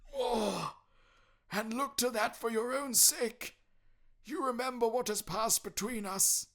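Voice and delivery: high, loud mournful spectre; anguished ghost lament, slowing at the end. Acted. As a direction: pour out regret like a damned soul. Ghost voice in story.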